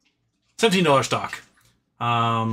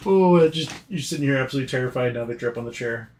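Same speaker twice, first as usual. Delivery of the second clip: normal talking voice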